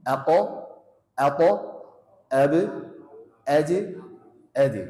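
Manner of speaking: Ako, Abu, Aji, Adi with bouncing stops; qalqalah bounce. Shown as a demonstration. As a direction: pop each stop like a rebound.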